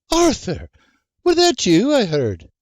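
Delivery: put-on high, carrying call to the old man; Anne calling up. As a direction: call out to him from the kitchen, suspicious. Anne calls to Papa B.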